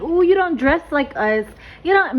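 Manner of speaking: put-on high, snooty mean-girl sneer; mean girl energy. Mocking the caller's classmates in a snooty mean-girl voice. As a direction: look down your nose at her clothes. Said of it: "mean girl energy".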